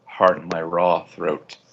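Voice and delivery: ordinary delivery, nothing marked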